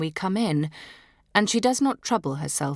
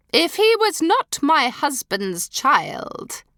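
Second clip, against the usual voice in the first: much louder, higher, slow and deliberate; bitter revelation; 9 semitones higher, 6 dB louder, slower than usual. Acted. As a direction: drop the scandal like a blow. Duchess shocking reveal.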